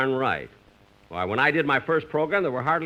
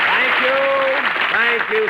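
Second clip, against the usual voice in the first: hearty greeting; 11 semitones higher, 7 dB louder, slower than usual. Real, not acted. Star greets cheering audience.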